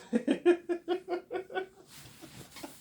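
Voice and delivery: high cackle; high laugh. Real, not acted. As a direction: laugh hard.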